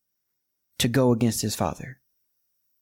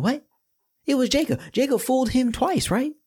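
doubting listener; 12 semitones higher than usual. Pretend. Voicing listener's objection.

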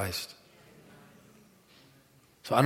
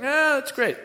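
high careless brush-off; mock the shrugging dog owner; high mocking imitation of the neighbor; dismissive neighbor; 14 semitones higher than usual. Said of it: "He'd be like".

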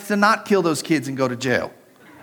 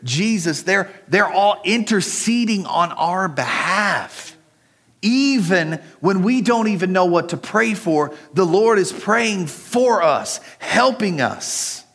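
higher, slowing, fervent preaching; preaching fervor; 4 semitones higher, slower than usual. Acted. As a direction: lift it up and slow down. Building sermon climax.

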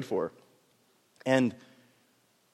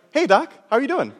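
brightly pitched, casual hello; cheery patient greeting; 9 semitones higher, 4 dB louder than usual. Retold or imitated. The revived patient greets his doctor, casual and upbeat. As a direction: toss it off lightly like nothing happened. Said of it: "his first words to me were".

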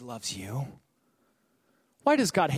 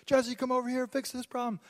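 higher, thin-volume whiny complaint; plaintive, pitched-up running grievance; pleading church member; 5 semitones higher, 4 dB quieter than usual. Acted. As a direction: act out a tattling congregant begging for help. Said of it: "somebody's like".

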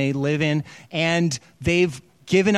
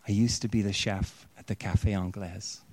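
quiet revelation; 6 semitones lower, 7 dB quieter than usual. Retold or imitated.

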